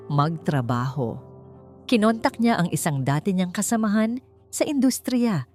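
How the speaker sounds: ordinary delivery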